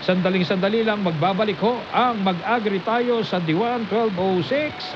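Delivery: ordinary talk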